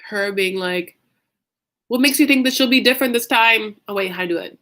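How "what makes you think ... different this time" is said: a light, loud Debbie impression; hint of Debbie's pointed question; mildly voiced Debbie quote; imitating Debbie. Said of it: "mimicking her voice".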